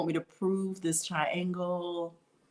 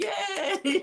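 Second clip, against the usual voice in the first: high, laughing cheer of delight; excited yay; 11 semitones higher than usual. Retold or imitated. Teacher cheering a right answer with a laugh, excited. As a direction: squeal it like you just got it right.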